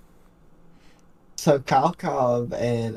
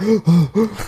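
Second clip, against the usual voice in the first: sharp mock gasp for breath; gasping for air; 7 semitones higher, 3 dB louder than usual. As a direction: imitate running out of breath mid-rant. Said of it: "he eventually got out of breath".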